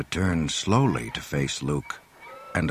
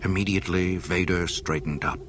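ordinary delivery

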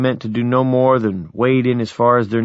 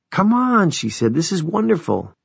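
high, delighted invitation; joyful; 8 semitones higher than usual. Acted. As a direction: beckon them in, beaming. Joyful nurse inviting them in.

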